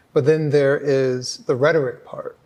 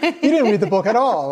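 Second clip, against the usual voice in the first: laughing disbelief; 7 semitones higher, 4 dB louder than usual. Retold or imitated. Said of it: "You're like".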